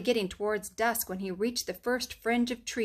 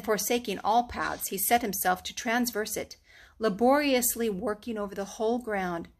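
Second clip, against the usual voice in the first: ordinary delivery, nothing marked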